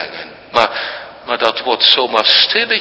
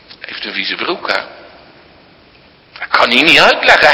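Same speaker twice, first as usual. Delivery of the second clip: normal talking voice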